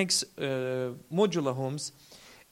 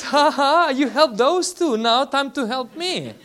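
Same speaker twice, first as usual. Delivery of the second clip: louder, higher mock grandma demanding her turn; cheeky grandma; 6 semitones higher, 6 dB louder than usual. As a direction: play the teasing babushka, loud and high. Grandma teasingly demands her house.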